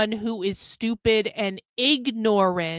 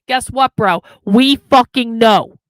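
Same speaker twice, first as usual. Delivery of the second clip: loud, slow exasperated yell; 10 dB louder than usual. Real, not acted. Streamer fed up with a chatter ranting about socialism, slow loud yell. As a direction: lay it down slowly and loudly.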